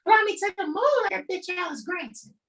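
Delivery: very high, fast mock yell; high-pitched rapid-fire parody; comic quote outburst. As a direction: rattle it off in a high silly voice. Comic movie-line outburst.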